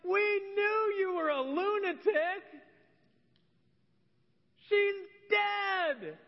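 high, hammed-up jeering cry; mocking. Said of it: "they're laughing, they're making fun".

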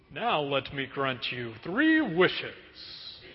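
low, slow, soft genie. Retold or imitated. Genie granting prayer wishes, low and slow.

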